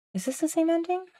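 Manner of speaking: high, incredulous question; puzzled disbelief. Real, not acted. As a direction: question it in high-pitched suspicion. Doubts the game ending just reached.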